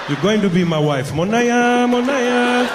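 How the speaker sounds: hammed-up African accent, rising into a high call. African man claiming a club woman as wife, African-accented call. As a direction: proclaim it in a mock African accent.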